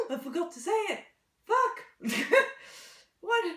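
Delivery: gasp, then a high, loud curse; shocked outburst. Real, not acted. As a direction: blurt it out in sudden shock. Forgot her catchphrase, swears.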